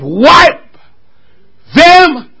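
word-by-word pulpit proclamation, climbing; loud, high decree with pauses; forceful preaching. Acted.

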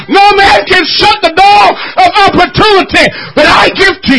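loud, high-pitched whooping sermon delivery; fervent preacher crying out to the church; full-force preaching. Acted. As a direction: preach it out with everything you've got.

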